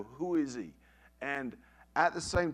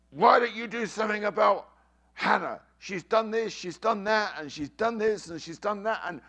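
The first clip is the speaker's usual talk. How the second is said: furious high, loud rant, imitating an enraged son; Joseph's angry outburst; 8 semitones higher, 8 dB louder than usual. Son at the bedroom door complaining about his sister, acted furious shouting. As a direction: shout the complaints in mounting exasperation. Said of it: "a complete epitome of anger".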